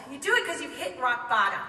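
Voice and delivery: plain reading voice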